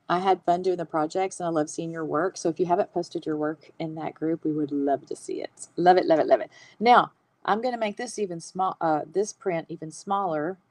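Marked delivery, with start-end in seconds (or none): 5.8-6.5 bright, loud gushing repeat. Real, not acted. Host gushing to viewers about their posted work. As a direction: gush over their lettering.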